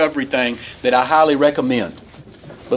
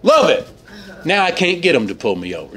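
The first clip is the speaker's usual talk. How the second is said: usual speaking voice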